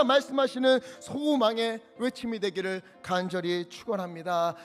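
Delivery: usual speaking voice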